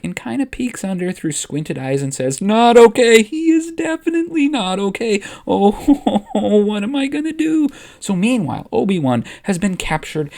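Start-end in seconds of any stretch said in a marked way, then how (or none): 2.4-7.7 much higher and louder, frantic with a nervous laugh; a young apprentice losing it with a shaky laugh; panicked Padawan. Acted. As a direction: spiral into panic over your master's body. Ahsoka sees Anakin crushed.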